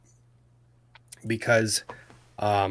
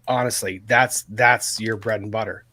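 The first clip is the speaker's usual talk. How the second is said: normal talking voice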